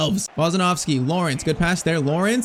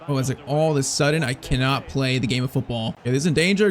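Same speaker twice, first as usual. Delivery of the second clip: ordinary talk, nothing marked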